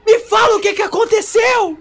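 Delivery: high loud frantic shouting mother; mother shouting at her son on the phone; desperate mom. Retold or imitated. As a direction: yell like a panicked mother on the phone. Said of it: "desesperada, falando assim".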